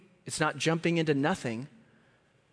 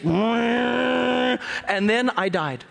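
very slow, drawn-out fake tragic line; dramatic deadpan; 7 semitones higher, much slower than usual. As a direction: stretch it for comic effect. Dramatic comic pause.

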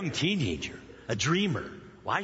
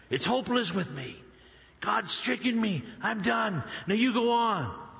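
put-on higher, bitter old widow sending them away; mildly acted hopeless scolding, pitched up; bitter resignation; 6 semitones higher than usual. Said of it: "You can see her attitude so clear".

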